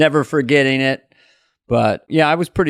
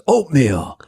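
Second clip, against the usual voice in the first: George Carlin; slower than usual. Retold or imitated. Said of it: "I waited on Carlin".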